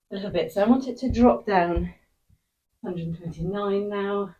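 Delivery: normal talking voice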